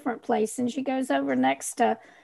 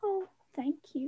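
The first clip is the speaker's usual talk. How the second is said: soft, higher, tender; touched thanks; 5 semitones higher, 10 dB quieter than usual. Acted. Mel thanks Arlo for looking out, softly touched. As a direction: thank them in a small voice.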